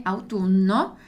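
autunno with a long held double n; autunno, double N clearly doubled. Shown as a demonstration. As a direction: lean on the nn in autunno. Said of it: "due N".

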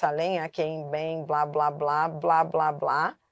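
low, level blah-blah-blah; rattled-off list. Real, not acted. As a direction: reel it off low, as filler. Reeling off a list, skipping the rest.

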